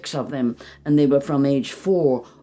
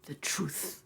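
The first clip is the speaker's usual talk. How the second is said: soft, whispery meditation voice; guided meditation; 13 dB quieter than usual. Acted. Teacher invites listeners to feel gratitude: soft meditation voice. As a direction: draw them gently inward.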